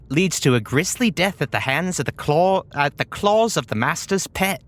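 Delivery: put-on character voice giving a grim warning; Rosie. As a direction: warn them as a fussy brain in a jar. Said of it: "It says".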